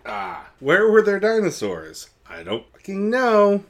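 high, loud flustered outburst; mock exasperation. [name] dodging George's dinosaur question, exasperated mock yell. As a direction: throw it out in exasperation.

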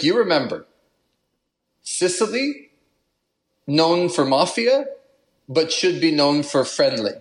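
mock Italian accent, pitch jumping high on Sicily; playful put-on Italian accent. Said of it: "I'm doing the Italian accent again".

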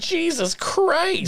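high, slow, stretched-out Jesus Christ; horrified gasp. Retold or imitated. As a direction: recoil at the creepy Muppet, drawn out. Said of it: "I'm like".

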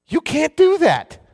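high, loud and slow, drawn out; emphatic outcry. Real, not acted. Preacher to congregation, loud, high and slow. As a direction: cry it out in disbelief. Preacher rebuts the self-reliant attitude.

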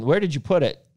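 odd-sounding question. Real, not acted. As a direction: ask it in an oddly sing-song way. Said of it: "That sounded weird".

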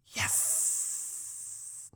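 soft, hissed, drawn-out exclamation; whispered victory cry in imitation; Napoleon Dynamite. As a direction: hiss it out under your breath in mock triumph. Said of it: "Napoleon Dynamite style".

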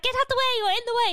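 very high, loud shooing-off yell; hammed-up mum chasing you out of the kitchen. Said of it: "she'd be like".

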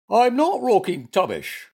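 high, loud, indignant bear voice; angry cry. A narrator's reading. Bear answers being scolded, high angry cry. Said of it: "cried Goompa angrily".

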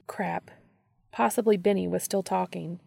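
ordinary delivery

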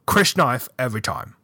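halting, wandering attempt at British; badly faked British sleuth explaining clues; shaky British accent. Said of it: "British just wanders all over the place".

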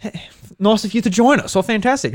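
a hint of a cheery announcer; mock presenter. Retelling the Direct's intro in a light host voice. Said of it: "it's like".

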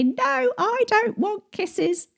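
put-on high, soft, slow little child's voice; child refusing. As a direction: play a small kid saying no to kisses. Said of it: "your child could then say".

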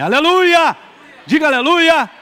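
shouted hallelujah. Real, not acted. Pastor leading the congregation in a loud hallelujah shout. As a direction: fire the crowd up to shout back.

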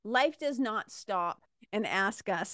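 normal talking voice

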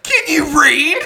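high, loud, laughing question; laughing mock bouncer. Retelling the bouncer mocking Fireball, high laughing question. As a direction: squeak it out laughing. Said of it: "the bouncer's like".

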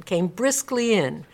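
normal talking voice

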